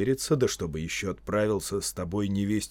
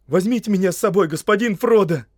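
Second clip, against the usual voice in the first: high, loud, fast begging; eager, desperate appeal; sudden pleading; 10 semitones higher, 10 dB louder than usual. Acted. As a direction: implore your master to take you along. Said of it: "взмолился он неожиданно".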